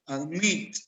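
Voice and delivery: "Admit" demonstrated with final-syllable stress; stress on last syllable. Shown as a demonstration. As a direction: Admit with the force on "-mit". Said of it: "la fuerza lo lleva en la última".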